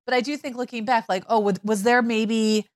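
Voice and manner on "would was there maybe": ordinary delivery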